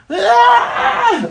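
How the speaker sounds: a shrill high yelp of fright; startled scream. Acted. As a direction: shriek as if caught. Usopp caught eavesdropping.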